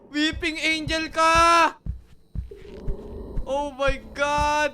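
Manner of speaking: high, slow panicked yelling; panicked shout. Real, not acted. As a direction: shout it out in a fright. Startled by Weeping Angel.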